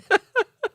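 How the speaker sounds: short high rising laugh; short high giggle. Real, not acted. Laughing at chat.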